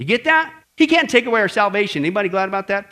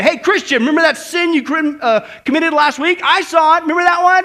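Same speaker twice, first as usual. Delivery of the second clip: loud, raised-pitch accusing jeer; taunting devil; 9 semitones higher, 7 dB louder than usual. Acted. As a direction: needle them with their guilt. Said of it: "the devil comes up to you and this voice".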